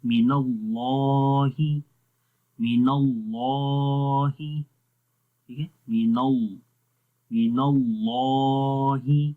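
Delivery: اللَّهِ with a full, heavy لا, said slowly; slow tajweed of مِنَ اللَّهِ; heavy lam in Allah. Shown as a demonstration. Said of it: "الف کیا ہوگا؟ موٹا ہوگا۔".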